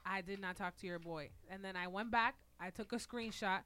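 normal talking voice

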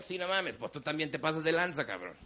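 low, soft sober reply to himself; inner voice. Retold or imitated. His inner voice answering him, low and calm. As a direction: talk yourself straight, quietly.

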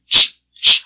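loud unvoiced "ch, ch" hiss to call someone; imitated psst hiss. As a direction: hiss "ch, ch" sharply like calling a stranger on the street. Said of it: "que te hacen".

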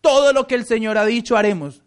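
all in one voice. Retold or imitated. The people answering Moses together, loud and eager. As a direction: shout it out like a whole crowd at once. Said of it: "a una sola voz".

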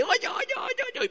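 high-pitched, urgent repeated calls; alarmed calling out. Retold or imitated. Passenger in the car warning the driver, high alarmed calls. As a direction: squeal it out like a startled passenger. Passengers peering through rain-soaked windshield.

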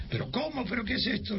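high, outraged mock protest; imitating a flustered man caught out; sputtering retold indignation; indignant outburst. Don Pedro outraged, red-faced.

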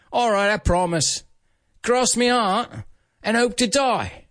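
high-pitched put-on British accent; British rocker accent. Band members parting in a taxi, high British accent. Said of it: "I'm gonna try and do it with my accent".

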